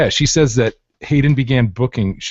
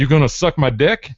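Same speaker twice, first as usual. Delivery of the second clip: higher-pitched, eager mock proposition; hammed-up lewd ask; crude proposition; 6 semitones higher, 4 dB louder than usual. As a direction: blurt it as a crude hopeful question. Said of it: "as I think E would".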